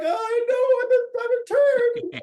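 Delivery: mope in a squeaky self-pitying voice; mock sulky voice of someone wallowing; self-pitying whine. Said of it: "They're like".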